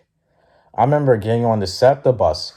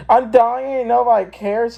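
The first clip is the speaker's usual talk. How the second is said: very high, hammed-up wail; mock desperate beggar; 14 semitones higher than usual. Retold beggar wailing at being ignored.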